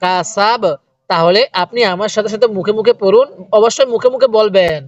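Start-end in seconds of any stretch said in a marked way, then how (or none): 0.0-0.6 কাসাব closed on a bouncing b, no final vowel; stopped ending on কাসাব; stopping at waqf. Shown as a demonstration. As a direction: end কাসাব on the consonant ব. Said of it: "কিভাবে পড়বো ওয়াকফ করলে?".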